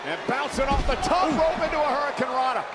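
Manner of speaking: excited high play-by-play shout; wrestling commentator. Real, not acted. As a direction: call the big move, thrilled.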